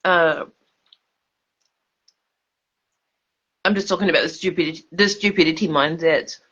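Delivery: talking while eating; words round a bite of food; mouth full. Real, not acted. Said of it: "Excuse me for eating while I'm speaking".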